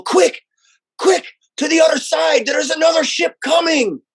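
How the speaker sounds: urgent shout. Acted. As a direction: raise the alarm in a panic. Disguised character yells at guards.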